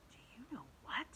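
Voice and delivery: hushed secret whisper. A narrator's reading. James telling friends about the arch, whisper. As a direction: breathe it conspiratorially. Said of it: "James whispered".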